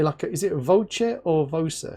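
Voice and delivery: ordinary talk, nothing marked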